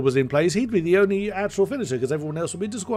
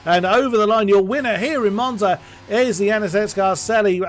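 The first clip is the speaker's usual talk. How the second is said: rising, louder race call over the line; excited finish call; 5 semitones higher, 3 dB louder than usual. Real, not acted. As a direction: call the winner home with excitement.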